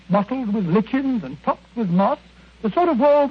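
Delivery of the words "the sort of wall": carried away. Acted. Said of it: "You may omit the Percy".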